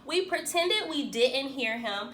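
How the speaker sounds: usual speaking voice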